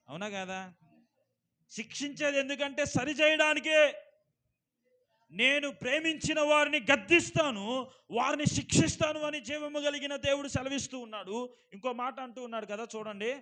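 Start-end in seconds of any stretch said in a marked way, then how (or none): 1.7-11.5 loud, ringing pulpit voice; fervent sermon delivery, raised and loud; preaching at full force. Acted. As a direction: preach it out to the whole hall. Preacher quotes scripture loudly.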